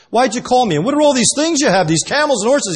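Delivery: high, loud, rapid-fire questioning; indignant Esau. Acted. Esau to Jacob about his possessions, high indignant questioning.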